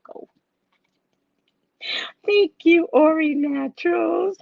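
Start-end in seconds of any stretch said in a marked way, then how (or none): 2.3-4.4 high, slow, tearful thanks. Real, not acted. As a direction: thank them with a crack of tears. Grateful, near tears.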